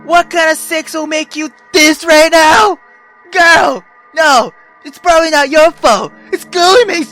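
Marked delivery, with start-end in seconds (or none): none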